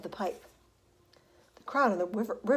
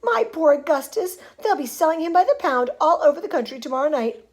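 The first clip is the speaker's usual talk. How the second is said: loud, raised motherly lament; distraught cry; 6 semitones higher, 7 dB louder than usual. A narrator's reading. Mother fearing son sold as fudge, distraught cry. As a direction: lament loudly for him. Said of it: "screamed Missus Gloop".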